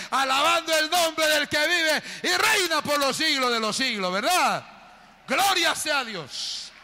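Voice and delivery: high, loud shout of praise; exultant preaching. Real, not acted. Preacher leads the church in praise, exultant shout. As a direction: build it into a rallying cry.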